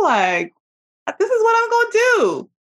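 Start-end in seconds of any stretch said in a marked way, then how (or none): 1.2-2.5 high, quick self-imitation of a bold plan; eager declaration. To her listeners, mock-eager past self announcing a plan. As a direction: rattle it off brightly, full of big plans.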